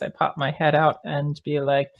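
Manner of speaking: ordinary talk, nothing marked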